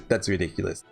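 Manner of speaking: normal talking voice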